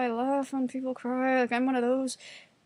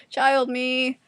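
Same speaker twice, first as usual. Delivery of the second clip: usual speaking voice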